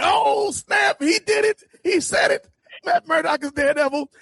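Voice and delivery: high, shouted mock excitement; hammed-up gasp of excitement; excited reaction. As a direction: squeal it like a thrilled fan.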